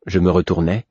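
ordinary delivery, nothing marked

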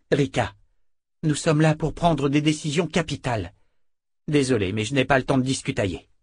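firm leader. Acted. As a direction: overrule her firmly. Character dialogue voiced in reading.

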